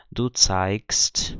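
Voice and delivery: zeigst pronounced with k before st; zeigst, G devoiced to a crisp k; K sound for G. Shown as a demonstration. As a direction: say zeigst as if spelt with a K. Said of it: "com som de K".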